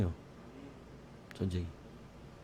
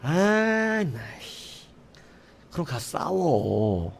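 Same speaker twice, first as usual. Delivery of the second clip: sleepy dismissal; 2 semitones higher, much slower than usual. Retold or imitated. Hungover sergeant brushing off war news, drowsy. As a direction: wave him off half asleep.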